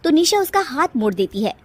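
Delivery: usual speaking voice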